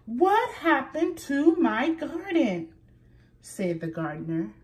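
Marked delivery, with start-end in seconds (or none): 0.1-2.7 loud, high outraged boom. A narrator's reading. The gardener booming at the two animals, outraged. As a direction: bellow it from above. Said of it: "terrible booming voice".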